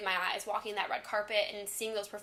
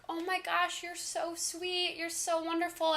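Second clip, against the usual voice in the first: higher, slow and sweet, lightly imitating the star; gushing star; 8 semitones higher, slower than usual. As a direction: coo it like a kind celebrity. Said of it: "and she's just like".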